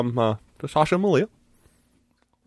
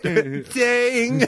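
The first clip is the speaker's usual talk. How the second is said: mock bell ding; 11 semitones higher than usual.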